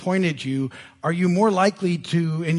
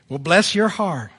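slow, laughing Southern "bless your heart"; sweetly condescending Southern drawl; mock Southern sweetness; Southern lady; 4 dB louder than usual. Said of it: "that Southern ladies say".

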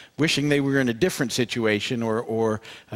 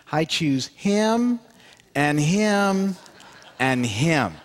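put-on a touch of a shy old lady, slow and higher, pointing out each man; timid old lady; 4 semitones higher, much slower than usual. Timid old lady picking men before the congregation, slow and higher. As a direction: pick them out slowly, one by one. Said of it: "she does very timidly".

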